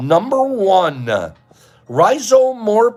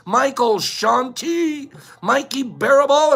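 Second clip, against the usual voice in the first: ordinary talk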